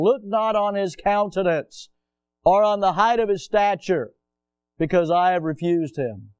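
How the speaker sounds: measured reading of King James verses; solemn scripture reading. Acted. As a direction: read the verse with weight. Reads scripture aloud.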